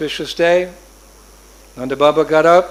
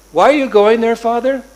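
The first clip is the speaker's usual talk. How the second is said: put-on higher and louder, eager little boy; childlike question; 6 semitones higher, 7 dB louder than usual. Little Krishna pressing his father, eager child. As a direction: press him like a curious child.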